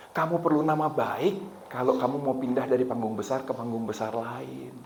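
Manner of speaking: soft, pointed inner voice; calm, hushed imitation of God. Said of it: "Terus Tuhan bilang ini".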